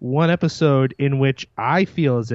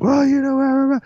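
mocking the Irish woman; 14 semitones higher, 3 dB louder than usual. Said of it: "they're like".

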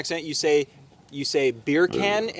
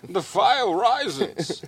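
very slow, drawn-out villain voice; Bane; 2 semitones higher, much slower than usual. Acted. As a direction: stretch it out like a theatrical villain. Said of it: "my go-to line to get into character".

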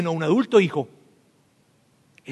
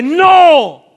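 louder no; 9 semitones higher, 8 dB louder than usual. Shown as a demonstration. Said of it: "más fuerte".

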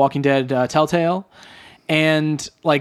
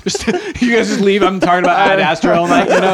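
laughing; 2 semitones higher than usual. Real, not acted. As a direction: talk through real laughter. Joking through laughter with co-hosts.

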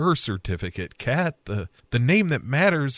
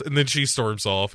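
usual speaking voice

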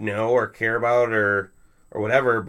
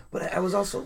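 excited; 9 semitones higher, faster than usual. Real, not acted. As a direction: blurt it out with delight. Excited overlapping comic talk.

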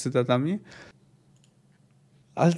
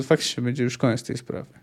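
usual speaking voice

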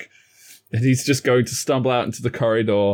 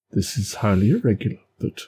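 a touch of fussy indignation; prim, sped-up complaint; lightly voiced fussy James; James in character; 5 semitones lower, faster than usual. Player voicing James in character.

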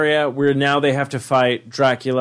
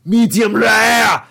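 loud, slow, higher monster-villain voice; Dracula comeback; 5 semitones higher, 5 dB louder, slower than usual. Retold or imitated. Pitching Dracula's reply to Arnold's punch, slow loud villain boom. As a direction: boom it out slowly like a smug vampire. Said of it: "And he'd be like".

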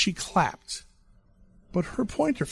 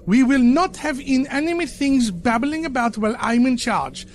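high, loud prim governess lecture; prim nanny scolding; 8 semitones higher, 4 dB louder than usual. A narrator's reading. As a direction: lay down the law like a prim nanny. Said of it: "Mary Popinesque".

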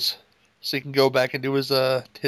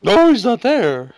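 high, loud, slow insistent denial; acted movie line; 9 semitones higher, 8 dB louder, much slower than usual. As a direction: belt it out, dragging it slowly.

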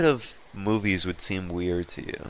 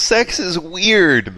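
slow, loud and high, each word stretched; emphatically stretched, much louder; drawn-out emphasis; 9 semitones higher, 7 dB louder, much slower than usual. Real, not acted. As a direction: lean into it, slowly and loudly.

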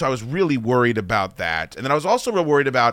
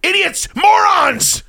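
slow, much higher shouted insults; mock angry shout; 4 dB louder, much slower than usual. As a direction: bellow the insults slowly, hammed-up. Mock ranting at audiences.

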